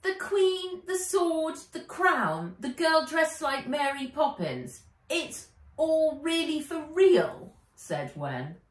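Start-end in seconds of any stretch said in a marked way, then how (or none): 0.0-7.5 put-on high, loud, slow amazed girl; Wen, amazed. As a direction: let it sink in, wide-eyed. Wen voiced in story.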